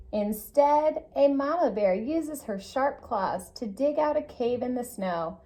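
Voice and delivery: ordinary delivery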